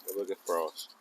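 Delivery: ordinary talk, nothing marked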